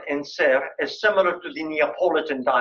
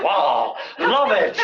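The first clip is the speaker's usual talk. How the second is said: mock delight; 2 semitones higher, 4 dB louder, much slower than usual. Students react to grammar.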